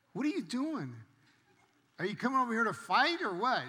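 high, loud exasperated questioning; baffled challenge. Retold or imitated. Speaker confronting the bully at his shop door, loud and baffled. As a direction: act out a bewildered confrontation.

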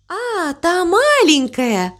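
high, slow, gentle reassurance; soft and soothing. A narrator's reading. Cow plays down crow's wave, softly soothing. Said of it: "мягко сказала корова".

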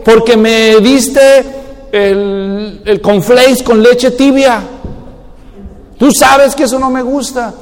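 slow, drawn-out petty complaint; petty sulky complaint. Acted. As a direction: sulk over something trivial, slowly.

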